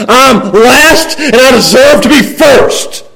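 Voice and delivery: high, loud indignant outburst; upset complaining. Acted. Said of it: "grumble and complain and be upset".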